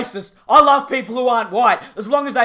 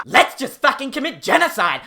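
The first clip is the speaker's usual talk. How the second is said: ordinary talk, nothing marked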